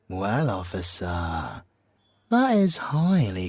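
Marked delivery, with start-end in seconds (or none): none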